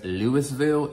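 Louisville with the S sounded, LEWIS-ville; wrong pronunciation. Shown as a demonstration. Mimicking someone on a flight, wrong pronunciation. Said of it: "you know it's not the same place".

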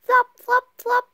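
high, loud, short flops; bouncy high-pitched footsteps; sound-effect words. A narrator's reading. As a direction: punch out each flop high and loud. Acting out Froggy's flopping steps.

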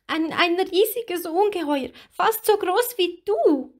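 very high, tearful small voice; halting high-pitched account, still upset; small frightened mermaid voice; little mermaid. A narrator's reading. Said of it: "sagte Lilli".

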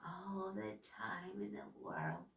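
soft, slow sinister near-whisper; hushed creepy witch. Acted. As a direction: breathe it out slowly and menacingly. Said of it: "she whispered".

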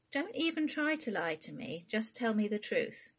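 high, loud insistence from a worried mother; a mother refusing to hear lies; stern mum. Acted. Queenie demands the truth.

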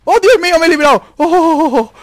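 high, loud burst of relief; real excited yelling; relieved shouting. Real, not acted. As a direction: whoop at a narrow escape. Narrow escape in game.

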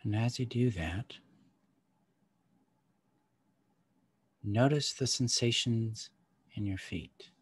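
calm guide easing them inward; guided meditation. Acted.

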